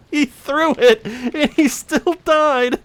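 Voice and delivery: high-pitched laughter through words; cracking up in disbelief; laughing. Real, not acted. Enemy blew himself up.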